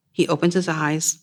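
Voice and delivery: ordinary delivery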